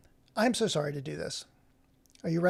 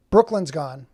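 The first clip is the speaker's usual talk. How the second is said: louder, falling announcement of the news; 8 dB louder than usual. Real, not acted. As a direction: call out that the viewer has left. Viewer left the chat.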